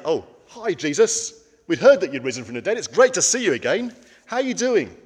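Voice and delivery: put-on high, loud breezy hello to an old friend; mock casual greeting. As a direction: play it chirpy and offhand, as a joke. Mock casual greeting disciples didn't give.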